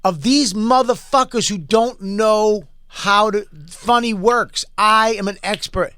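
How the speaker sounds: mock-tearful complaint about the producers; imitated tearful rant. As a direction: retell his crying rant with pitch rising. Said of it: "And he started crying".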